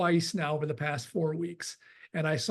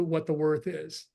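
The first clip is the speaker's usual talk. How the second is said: ordinary delivery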